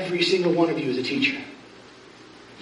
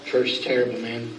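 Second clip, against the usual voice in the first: mock grumble; 7 semitones lower, 5 dB quieter than usual. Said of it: "what you hear from other preachers".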